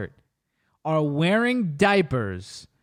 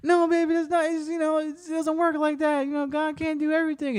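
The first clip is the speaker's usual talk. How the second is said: put-on much higher, soft girlfriend voice; mock girlfriend comforting; 12 semitones higher, 4 dB quieter than usual. Mocking the girlfriend soothing the son, much higher comforting voice. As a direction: comfort him in a mocking high falsetto. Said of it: "She's like".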